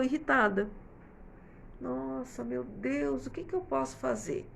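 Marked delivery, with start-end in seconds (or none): 1.8-4.5 softly voiced weary despair; a tired mother at her wits' end, lightly acted; exasperated sigh. Exasperated mother at messy toys. (mãe cansada e desanimada, em voz baixa)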